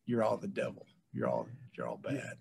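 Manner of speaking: low, hushed, doom-laden; lightly voiced preacher of doom; mock condemnation. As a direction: pronounce them all wicked, deep and soft. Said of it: "and I just".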